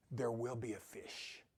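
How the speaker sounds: soft hushed whisper, voice dropped away; reading an old Ovid line to listeners, whispering its close. Acted. Reading Ovid quote, hushed ending.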